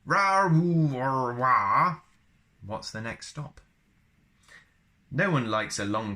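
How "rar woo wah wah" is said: loud, high mock Wookiee howl. Shown as a demonstration.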